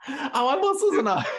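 real embarrassed laugh. Real, not acted. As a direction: blurt it out through a high laugh. Caught out about the impression, laughing.

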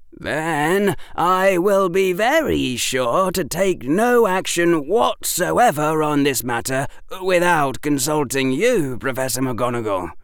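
put-on precocious boy, emphatic and dutiful; sobered schoolboy. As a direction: stress each assurance in an earnest pupil's voice. Narrator voices Harry, chilled, promising.

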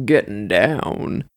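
low, drawn-out soul crooner. Pretend. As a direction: drop deep and slow, mock-seductive.

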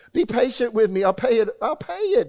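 high, loud desperate begging, voice jumping up on the last pay it; pleading servant. Acted. Indebted servant begging his creditor, high loud pleading. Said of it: "he pleaded".

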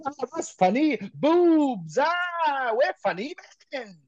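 high-pitched, goofy self-mockery; put-on high voice, pleased with a juvenile joke; silly jokers. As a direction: squeal it like giggly daft lads. Mocking their younger selves naming the band.

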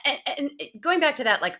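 normal talking voice

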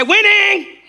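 "Winning" high and loud, dramatic and gloating; petty whining grievance. Shown as a demonstration. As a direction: shout "Winning" like you're proving your spouse wrong. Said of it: "Get your dramatic voice ready. I'm talking about that petty voice".

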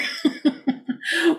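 laugh. Real, not acted. Laughing at own story.

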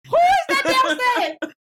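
high, slow, startled shriek. Retold or imitated. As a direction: jump at a stranger downstairs. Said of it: "she just walked in like".

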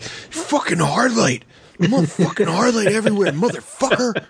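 high shrill-free excited yelling; excited cursing. Retold or imitated. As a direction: rant like a kid gone wild. Excited cursing about gun.

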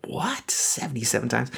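hushed, high-pitched incredulous question; disbelieving disciples. Retold or imitated. Disciples reacting to Jesus' number, in hushed disbelief. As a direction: imitate stunned disciples, under the breath. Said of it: "looking at him like".

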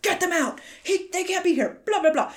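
mock urgent shout, high-pitched; hurried high yell trailing into blah-blahs; urgent order. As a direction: bark it out like a retold movie hero. Retelling Keanu ordering choppers away.

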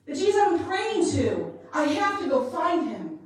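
loud, rising preacher's cry; loud, climbing preaching; full-force preaching. Acted. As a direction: preach it out with mounting urgency. Preaching with building intensity.

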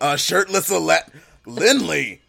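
high, loud scandalized call; loud exclaim. Real, not acted. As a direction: yell her name in mock shock. Mock outrage at co-host.

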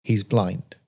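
understated, matter-of-fact delivery; plain statement of hard news. Acted. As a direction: break the news as plainly as you can manage. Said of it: "most matter-of-fact voice".

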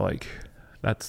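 ordinary talk, nothing marked

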